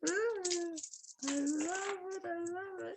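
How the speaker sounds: high, soft, delighted cooing. Real, not acted. Unwrapping a gift from Kimmy, she coos in delight. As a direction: swoon over the gift in a high voice.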